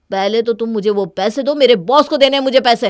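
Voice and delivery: loud, insistent demand for the money; angry demand. Acted. Husband yelling at wife to return the boss's money, angry. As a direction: yell that the boss must be paid.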